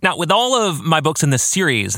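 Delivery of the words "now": usual speaking voice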